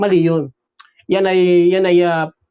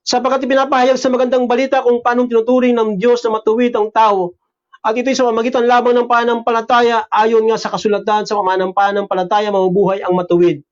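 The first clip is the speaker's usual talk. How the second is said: a lightly raised preaching read of the verse; scripture reading; 4 semitones higher than usual. Acted. Pastor reads Romans to the congregation, raised reading voice. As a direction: read the Bible passage out to the congregation, lifted.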